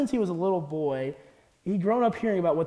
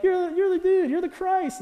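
much higher, excited voicing of Peter; eager Peter; 12 semitones higher, 4 dB louder than usual. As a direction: play Peter blurting out the answer proudly. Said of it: "Peter's like".

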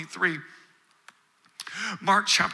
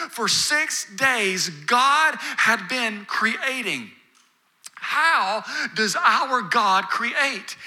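slow, drawn-out preaching; much slower than usual. Acted. Preacher drawing out the point.

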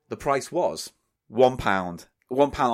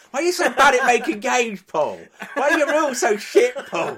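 mocking whine; 11 semitones higher, 4 dB louder than usual. Mocking Eli's whiny complaints.

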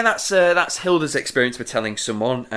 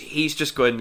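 ordinary delivery

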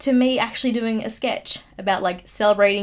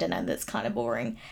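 muttered under the breath, much softer; quiet aside; 10 semitones lower, 8 dB quieter than usual. Real, not acted. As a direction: drop the voice for a sheepish confession. Self-deprecating aside.

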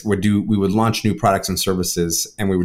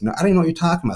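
much higher, puzzled friend's voice; friend's baffled denial; 9 semitones higher, much faster than usual. Retold or imitated. Friend Shelby voiced in story.

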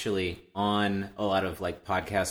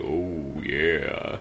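low demo oh yeah; pitched-down voice; 5 semitones lower than usual. Shown as a demonstration. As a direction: pitch your voice right down. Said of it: "pitch your voice down and probably do".

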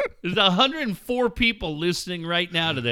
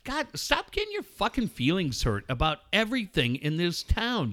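ordinary delivery

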